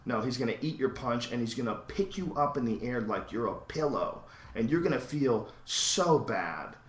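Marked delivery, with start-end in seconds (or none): none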